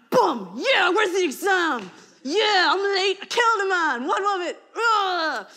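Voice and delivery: high, loud swaggering tough act; hammed-up swagger; mock badass. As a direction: strut in cocky. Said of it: "sort of be like".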